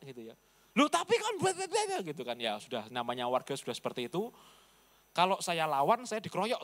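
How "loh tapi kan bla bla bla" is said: much higher, mocking villager voice on "bla, bla". Preacher parodying villagers arguing back at him, mocking. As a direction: parody the nagging crowd.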